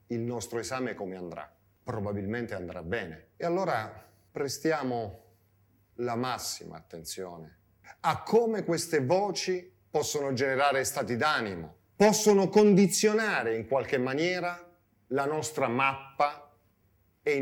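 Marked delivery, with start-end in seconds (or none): none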